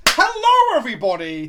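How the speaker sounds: big high shouted hello; excited greeting. Real, not acted. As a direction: burst out with a loud, high hello. Greeting chat on return to facecam.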